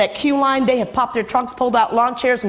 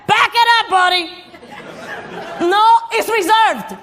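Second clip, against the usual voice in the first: a hint of a cranky old woman guarding a parking spot; cronish voice; 8 semitones higher than usual. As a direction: bark it like a bossy old crone. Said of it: "that cronish voice saying things like".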